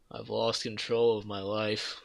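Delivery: quiet, slow, defeated line; despairing. Retold or imitated. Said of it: "He was like".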